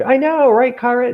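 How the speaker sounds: usual speaking voice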